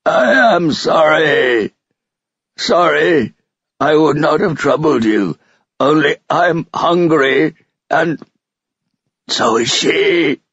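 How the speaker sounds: high, drawn-out pitiful wail; hopeless, pathetic. A narrator's reading. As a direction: cry it out slowly from a great distance, pleading. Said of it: "sounding extremely hopeless and pathetic".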